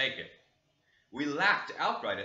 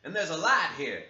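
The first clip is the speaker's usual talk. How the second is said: loud, higher servant's voice; servant reporting; 3 semitones higher, 8 dB louder than usual. Acted. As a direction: report it eagerly to the master. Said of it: "he added".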